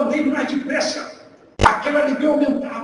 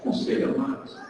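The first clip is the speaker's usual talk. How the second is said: soft eager plea; 9 dB quieter, much slower than usual. Retold or imitated. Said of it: "que fala".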